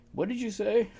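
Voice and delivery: high, put-on incredulous voice; imitating her disbelief. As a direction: mimic her asking in disbelief. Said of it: "You know".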